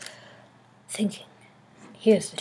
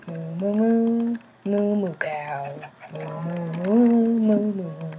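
mooing like a cow, loud and drawn out; 4 dB louder than usual. Retold or imitated. Builder mooing at the cows in his pen, playful. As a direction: moo at the cows like one of them.

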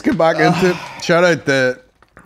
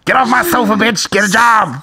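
raised comic scolding; Dave Chappelle impression; 6 semitones higher, 4 dB louder than usual. Comic ordering mother off sofa, high mock scolding. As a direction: order them about in a stand-up's voice. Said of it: "with the Dave Chappelle voice".

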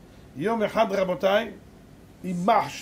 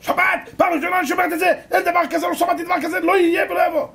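high, loud landlady tirade; acted shouting fit; furious outburst; 11 semitones higher, 8 dB louder than usual. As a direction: rage at the hired girl at full volume. Landlady rages at the refusal.

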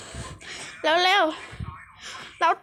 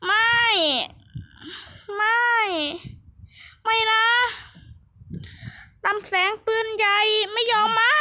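despairing wail; 7 semitones higher than usual. Pretend. Player cries that the cannon beam won't come, despairing wail. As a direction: whine in panic, rising to a wail.

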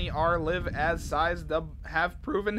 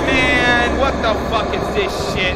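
loud, higher exasperated yell; angry shout; 5 semitones higher, 10 dB louder than usual. Real, not acted. Streamer yelling at a glitched game.

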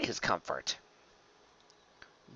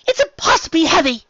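high, loud, straining complaint; exasperated wail; 9 dB louder than usual. A narrator's reading. Rarity kicking uselessly under the harness, exasperated wail. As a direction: wail under a crushing weight.

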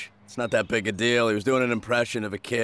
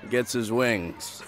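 ordinary delivery

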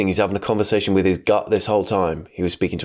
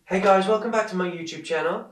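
character voice; 6 semitones higher than usual. Pretend. Voicing a character's shock.

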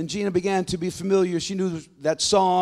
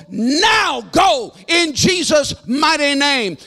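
full-force preacher, high and building louder; commanding preaching; 8 semitones higher, 7 dB louder than usual. Retold or imitated. Preacher casting out a witch doctor in Jesus' name, commanding shout. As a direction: thunder it out as a banishing command.

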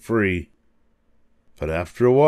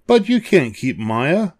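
put-on higher-pitched girl's protest; protesting cry; 7 semitones higher than usual. A narrator's reading. Lucy objecting to the monsters, high protesting cry. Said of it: "Lucy cried".

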